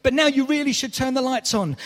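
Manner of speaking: high, loud exasperated pleading; will them to flip the switch; scolding a horror film character through the screen; urging the TV. Pretend. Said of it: "you're saying".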